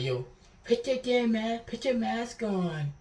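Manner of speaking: retold teacher yelling at a kid to mask up. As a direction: yell it like an angry teacher.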